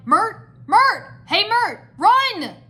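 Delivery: high, loud repeated calls; urgent calling. A narrator's reading. Cole urges Mert to flee, urgent yelling. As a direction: yell to get a dawdler's attention.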